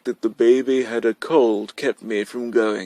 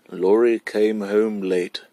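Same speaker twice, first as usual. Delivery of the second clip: ordinary delivery, nothing marked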